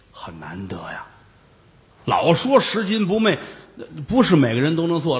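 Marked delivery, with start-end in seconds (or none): 0.1-1.1 whisper. Real, not acted. Storyteller aside on honesty.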